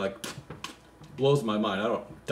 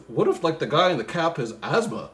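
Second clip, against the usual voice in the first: ordinary talk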